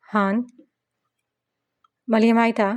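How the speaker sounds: lightly voiced lisping little boy; a hint of a child's lisp, r as l; mild toddler lisp. A narrator's reading. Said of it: "अपनी तोतली लहजे में बोला".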